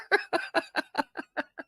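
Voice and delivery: high falling burst of laughter. Real, not acted. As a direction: let a big high laugh tumble down. Laugh after joke.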